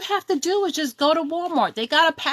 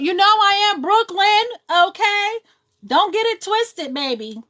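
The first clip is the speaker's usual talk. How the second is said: put-on loud, higher, sassy Brooklyn accent; 6 semitones higher, 4 dB louder than usual. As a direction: Brooklyn girl showing off her New York accent, louder. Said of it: "You heard the accent".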